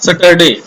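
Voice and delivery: Saturday said as sutt-er-day; common mispronunciation. Shown as a demonstration. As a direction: imitate the common error, Saturday with short uh first. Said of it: "People generally say it". (Saturday को गलत 'सटरडे' बोलना)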